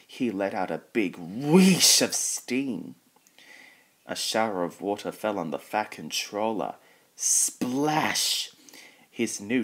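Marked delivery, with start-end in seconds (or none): 1.2-3.0 swelling, higher whoosh like steam; loud whoosh. A narrator's reading. Narrator imitating James letting out steam, loud swelling whoosh. 7.1-8.5 high, punchy sound-effect word; exclaimed splash. A narrator's reading. Narrator as water hits the Fat Controller, high exclaimed splash.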